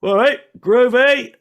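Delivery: drawn-out, high, loud fan hello; excited fan greeting. Retold or imitated. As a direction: call out to him like a thrilled stranger in the street. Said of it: "people see this old bloke ... and go".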